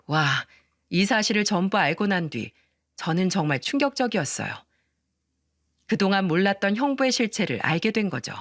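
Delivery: ordinary delivery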